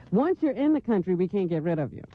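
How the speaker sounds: usual speaking voice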